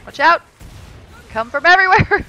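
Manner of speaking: high, loud warning to the team; shouted warning. Real, not acted. Gamer warning teammates of a swarm, urgent shout.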